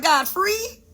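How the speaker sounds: high, rising mock disbelief; incredulous question. Questioning God's instruction.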